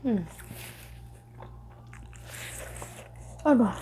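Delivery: gasping at chili heat; hot pepper burn in the throat; mouth burning from spicy food. Real, not acted. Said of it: "Tenggorokanku rasanya kobong". (kepedasan, mulut terbakar cabai)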